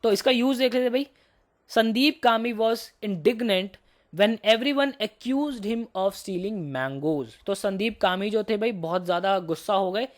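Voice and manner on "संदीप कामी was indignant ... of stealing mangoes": very slow, word by word; slow dictation. Shown as a demonstration. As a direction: dictate the sentence slowly. Teacher dictates example sentence.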